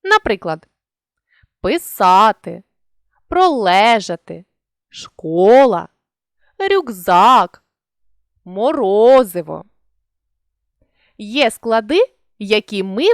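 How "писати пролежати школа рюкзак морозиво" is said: each word slow and louder, with the stressed syllable hit hard; teacher showing stress; stressed syllables pushed. Shown as a demonstration. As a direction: lean on the stress in писати, пролежати, школа, рюкзак, морозиво. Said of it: "склади з неоднаковою силою голосу".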